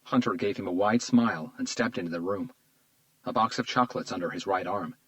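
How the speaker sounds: ordinary delivery, nothing marked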